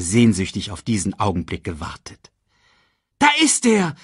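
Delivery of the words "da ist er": high, excited cry from the crowd; excited call. A narrator's reading. Someone in the crowd spots the prince, excited call. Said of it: "rief jemand".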